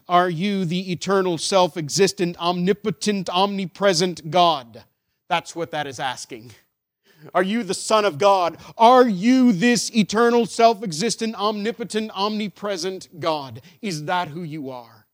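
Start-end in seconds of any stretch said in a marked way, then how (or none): 0.1-4.8 loud, emphatic pulpit question; preacher pressing a weighty question; forceful preaching. Real, not acted. 7.3-15.0 loud, high pulpit question, easing at the end; preacher pressing the question with rising force; impassioned preaching. Real, not acted. Preaches the question, building then easing.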